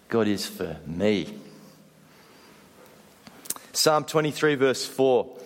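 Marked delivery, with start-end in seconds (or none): 0.1-1.3 low and slow, landing on a separate "me"; stress on me. Shown as a demonstration. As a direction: set "me" apart at the end, keeping it low. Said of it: "putting the emphasis in different places".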